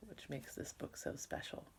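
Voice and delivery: soft whispered aside; whispering. Real, not acted. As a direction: breathe it out quietly. Aside to class.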